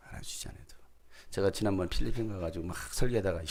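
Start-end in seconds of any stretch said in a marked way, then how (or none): 0.1-0.6 알아주지 않아도 repeated in a bare whisper; soft whispered repeat; whispered echo. Shown as a demonstration. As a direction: let the words fall to a whisper.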